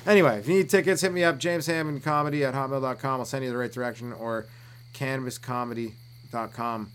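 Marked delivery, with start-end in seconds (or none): none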